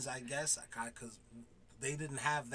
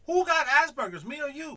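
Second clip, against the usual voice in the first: high, loud comeback; retold outraged challenge; indignant retort; 12 semitones higher, 11 dB louder than usual. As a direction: throw the question back hard.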